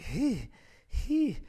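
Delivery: winded puffing after a sprint; out of breath. Retold or imitated. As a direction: gasp it out like you're winded from running. Out of breath running.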